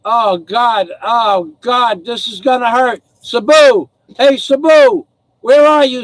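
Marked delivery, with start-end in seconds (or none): 3.2-6.0 loud, high calls for the dog; calling out loud for a missing dog. Real, not acted. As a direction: shout for the dog to come back.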